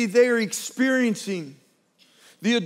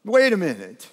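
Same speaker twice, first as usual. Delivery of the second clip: louder, sure of himself now; a firmer, louder second wait a minute; dawning realization; 3 semitones lower, 3 dB louder than usual. Pretend. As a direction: call it out as it clicks. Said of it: "one brave soul looks over ... goes".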